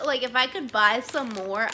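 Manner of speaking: usual speaking voice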